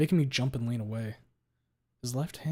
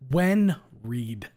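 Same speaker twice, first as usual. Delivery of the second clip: louder, pressing the question; forceful demand; 7 dB louder than usual. Acted. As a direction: cut him off hard. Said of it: "I cut him off".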